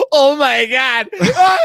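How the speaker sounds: high, loud, near-crying shriek of a starstruck fan; overwhelmed fanboy crying out, high and loud; fanboy freakout. Retold or imitated. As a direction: squeal like meeting your idol, voice cracking. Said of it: "I was total fanboy".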